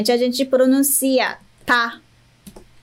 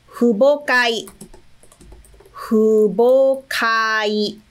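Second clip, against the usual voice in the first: slow model word; much slower than usual. Shown as a demonstration. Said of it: "Por exemplo".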